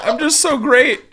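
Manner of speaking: high put-on voice; mock proud crier. Friend joins the bit, high mock voice. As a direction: squeak it proudly.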